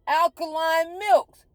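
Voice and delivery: alkaline milks said slow and high, each word hammered; spelled out slowly. Shown as a demonstration. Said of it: "There's five".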